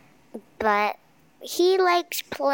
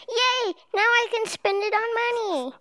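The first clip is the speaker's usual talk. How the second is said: excited; 10 semitones higher than usual. Retold or imitated. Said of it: "I'm like".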